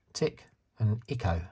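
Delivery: New Zealand short i in "Tick and Echo"; New Zealand accent. Retold or imitated. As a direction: imitate New Zealand vowels, "Echo" as "Icko". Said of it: "but she calls them".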